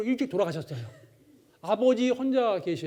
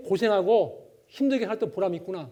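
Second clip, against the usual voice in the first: normal talking voice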